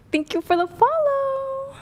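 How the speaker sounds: bikini barista voice.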